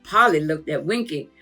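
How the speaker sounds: usual speaking voice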